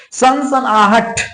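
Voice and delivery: सनसनाहट said very slowly, each syllable apart; teacher drags out सनसनाहट to reveal its suffix; slow spoken demo. Shown as a demonstration. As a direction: stretch सनसनाहट so the आहट ending is clear. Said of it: "नाम से बोलने पर ही आहट आ रहा है".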